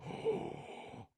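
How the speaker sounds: long audible intake of breath; shocked gasp. Acted. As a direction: gasp at what is inside the present. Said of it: "she goes".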